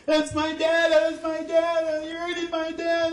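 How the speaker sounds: put-on high, slow panicked child cry; child's frightened scream. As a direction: scream for your dad in terror. Son screaming upstairs.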